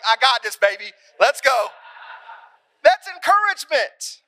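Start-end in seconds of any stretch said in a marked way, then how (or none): 0.1-1.7 loud, high burst of confidence; pumped-up confidence. Acted. Performer to self after seeing mama, excited shout. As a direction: kid on stage after mom's smile, pumped-up shout. Confident kid encouraged on stage.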